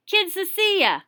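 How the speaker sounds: loud high shopkeeper call; shout. Acted. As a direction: holler it to the back room. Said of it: "Missus Barman shouted".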